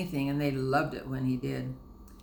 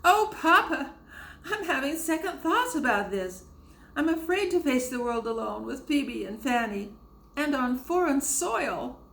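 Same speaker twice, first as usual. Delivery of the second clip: high, loud, crying plea; tearful pleading; 11 semitones higher, 4 dB louder than usual. A narrator's reading. Susanna begging her father in tears, tearful. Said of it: "burst into tears".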